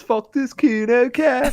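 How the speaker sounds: high, slow Scouse voice; squeaky Liverpool accent, drawn out. Shown as a demonstration. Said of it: "whenever I do a Liverpool accent".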